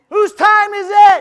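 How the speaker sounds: high, loud, slow rally call; rally shout. Real, not acted. Speaker shouting to the crowd for Dave, at full volume. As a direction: roar it out to fire up the crowd.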